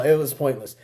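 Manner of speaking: ordinary talk, nothing marked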